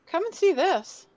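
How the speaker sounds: high, loud call to the others. Acted. Lily calls the party over to the arm, calling out. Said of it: "Lily kinda gives a shiver and calls out".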